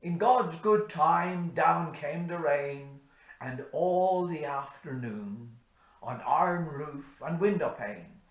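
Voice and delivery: loud, high, ringing recital; bush poem recitation. Acted. Reciting Says Hanrahan for parishioners, loud declaiming. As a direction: declaim the ballad to the whole parish.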